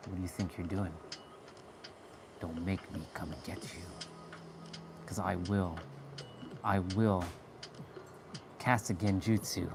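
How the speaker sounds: low, hushed menacing threat. Retold or imitated. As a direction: quietly warn them you will come for them. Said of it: "I give them the look".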